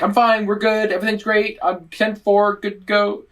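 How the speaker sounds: high, breezy fake all-clear; Han Solo bluff. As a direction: bluff it like nothing's wrong while it all falls apart. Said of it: "a Han Solo moment".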